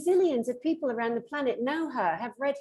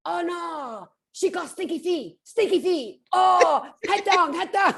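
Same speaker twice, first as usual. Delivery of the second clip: high mock Vietnamese accent, growing louder; 8 semitones higher, 4 dB louder than usual. Imitating nail technicians mocking a customer's feet, Vietnamese accent. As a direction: mimic a Vietnamese nail technician's alarm, pitched up. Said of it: "I love her accent".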